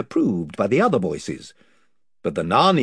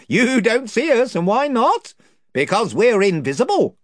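higher, blustering chief; invisible chief; 12 semitones higher, 5 dB louder than usual. Acted. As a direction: boast of his band's numbers.

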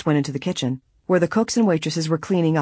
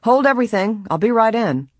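high, raised call; shouting from outside; 7 semitones higher, 4 dB louder than usual. A narrator's reading. John shouts from the garden during blackout, calling out. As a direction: call it out, pitched well up. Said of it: "John was heard shouting from the garden".

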